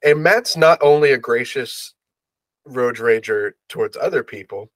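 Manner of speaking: ordinary talk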